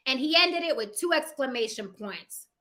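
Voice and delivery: normal talking voice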